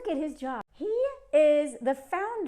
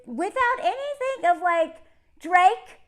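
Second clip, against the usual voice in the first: ordinary delivery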